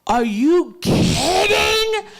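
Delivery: high, slow, disbelieving; drawn-out high are you kidding; incredulous. Retold or imitated. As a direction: stretch it out in disbelief. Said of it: "I was like".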